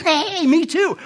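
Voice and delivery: high, loud, excited greeting; hammed-up delight. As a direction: exclaim it with comic over-eagerness. Joke character finds common ground.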